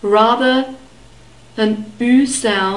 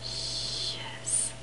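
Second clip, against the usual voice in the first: unvoiced, breathy "yes, yes"; whisper; 18 dB quieter than usual. Shown as a demonstration. Teacher whispering to show learners the soft ch sound. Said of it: "So try to whisper".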